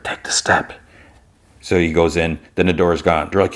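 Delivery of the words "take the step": a breathy unvoiced whisper. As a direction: whisper it like a hidden voice. Mysterious voice heard, unvoiced.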